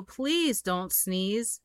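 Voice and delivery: drawn-out slow plea; slow pleading. Acted. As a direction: stretch the plea out slowly. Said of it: "cried the monkeys".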